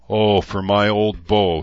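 put-on seething as old enemies ride past; a slow, bitter growl. A narrator's reading. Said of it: "he growled".

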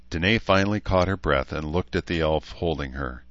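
usual speaking voice

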